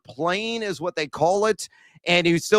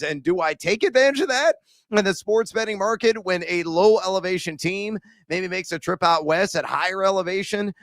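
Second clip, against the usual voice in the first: ordinary delivery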